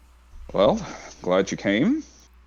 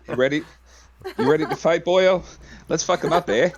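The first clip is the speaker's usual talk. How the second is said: put-on Canadian accent; 8 semitones higher, 4 dB louder than usual. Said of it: "He's Canadian".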